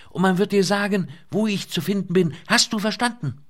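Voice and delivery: high, loud urgent woman's voice; young woman shouting. A narrator's reading. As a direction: raise voice over the din. Lynette keeps shouting over rotors.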